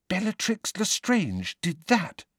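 slow, hushed and horrified; horrified whisper. A narrator's reading. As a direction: breathe it out in shock. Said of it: "whispered Hermione, horrified".

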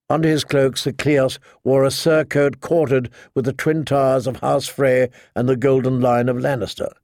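ordinary delivery, nothing marked

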